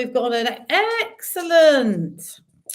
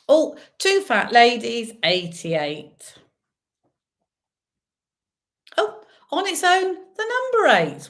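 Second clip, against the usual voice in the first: bingo caller; 4 dB louder, slower than usual. Acted. Bingo calling numbers.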